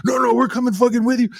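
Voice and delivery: put-on drunk fan voice. Drunk fans insisting to wrestler.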